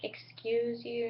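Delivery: soft, slow, put-on offended "excuse you"; jealous indignation. Jealous girl eyeing the older woman, soft slow indignation. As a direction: quietly bristle at the rival.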